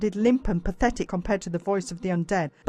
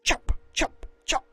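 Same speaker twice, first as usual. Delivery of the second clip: zombie chomping; 7 semitones higher than usual. A narrator's reading. Zombie teeth chomping, imitated.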